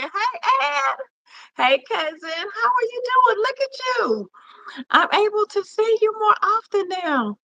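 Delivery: delighted welcome to a cousin; excited greeting. Real, not acted. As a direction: greet him like long-lost family. Greeting arriving viewers on live.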